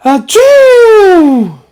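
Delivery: a loud, high, explosive achoo; big sneeze. Acted. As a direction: blast out a huge cartoon sneeze. Gerald sneezes at Doctor Cat.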